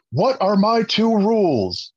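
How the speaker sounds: a hint of a barking drill instructor quizzing the class; R. Lee Ermey. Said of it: "I go full R. Lee Ermey".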